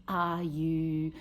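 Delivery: ordinary talk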